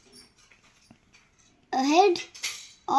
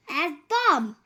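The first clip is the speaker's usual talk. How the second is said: ordinary talk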